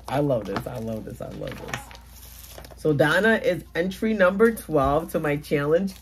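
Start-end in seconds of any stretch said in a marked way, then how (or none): 0.1-1.8 low murmur fading into a whisper; overwhelmed murmur. Real, not acted. Crafter overcome by a friend's entry, fading murmur. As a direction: let it sink away to a soft whisper.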